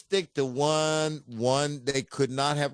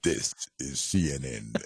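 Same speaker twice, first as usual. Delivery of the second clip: James Earl Jones impression; 12 semitones lower than usual. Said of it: "I'm James Earl Jones".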